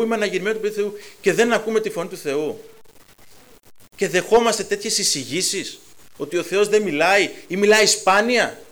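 loud, driving sermon voice, pushing each question; fiery preaching. Real, not acted. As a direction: preach it out full force to the congregation. Preacher at full force.

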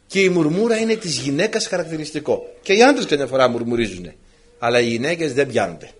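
usual speaking voice

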